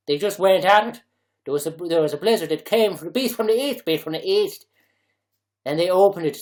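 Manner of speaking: put-on loud, rambling Dublin accent. Mock Dubliner telling of blizzard looting, loud Dublin accent. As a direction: spin the yarn as an animated Dubliner. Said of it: "put Dublin accent into".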